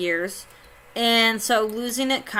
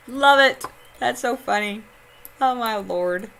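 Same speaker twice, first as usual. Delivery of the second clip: ordinary delivery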